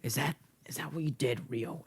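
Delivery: fast accusing whisper; mock interrogation in a whisper. Real, not acted. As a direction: hiss the question quickly.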